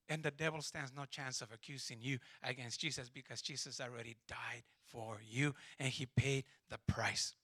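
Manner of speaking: normal talking voice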